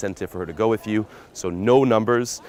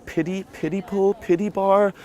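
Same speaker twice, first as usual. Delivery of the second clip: high, slow mock-feeble voice; hammed-up apologetic pitch for a lousy place; permission-seeking; 10 semitones higher, slower than usual. Shown as a demonstration. Pickup coach mocking a shabby bar invitation, permission-seeking. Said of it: "that permission even communicates".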